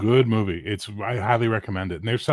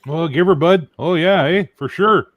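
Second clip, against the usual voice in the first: put-on higher, louder Ontario accent; 7 semitones higher, 7 dB louder than usual. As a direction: ham up a small-town Ontario accent. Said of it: "I've never heard anyone talk like that".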